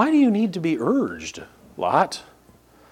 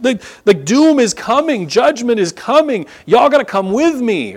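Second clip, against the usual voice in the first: higher, louder prophet's call of doom; urgent warning; 8 semitones higher, 5 dB louder than usual. Retold or imitated. Lot warning his sons-in-law, higher and louder urgent plea. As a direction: warn them that judgment is coming. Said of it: "his son-in-laws are like".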